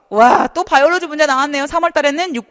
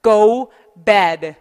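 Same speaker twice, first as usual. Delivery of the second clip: 1 slow model phrase; 2 semitones lower, 5 dB louder than usual. Shown as a demonstration. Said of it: "스피킹에서 음식 상하다는 뭐라고 하냐면".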